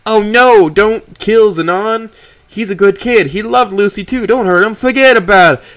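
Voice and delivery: high, loud fake Italian accent, taunting; mocking Italian accent. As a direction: parody him in a hammed-up Italian accent. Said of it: "I mock him with a fake Italian accent".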